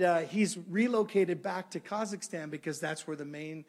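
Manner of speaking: normal talking voice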